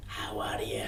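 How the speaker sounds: hushed Scottish whisper; whispered Death. Acted. Death greeting Hamish at the door, hushed whisper. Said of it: "do the accent".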